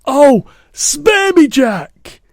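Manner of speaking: high, loud, drawn-out plea; pleading yell. A narrator's reading. Giant begs dream Jack to spare him, desperate yell. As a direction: yell for mercy. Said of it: "he yelled".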